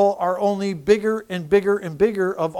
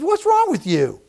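mom's higher, puzzled voice; mom asking; 8 semitones higher, 3 dB louder than usual. Retold or imitated. Mother wondering what changed in her son, higher pitch. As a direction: lift it up high like his mother. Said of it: "She says".

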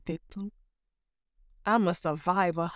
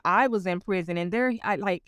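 usual speaking voice

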